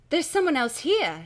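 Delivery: high, loud alarmed cry. A narrator's reading. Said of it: "Stacy cries".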